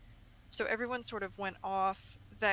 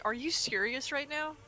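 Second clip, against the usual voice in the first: exasperated; 6 semitones higher, 4 dB louder than usual. Retold or imitated. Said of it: "Hrothgar was like".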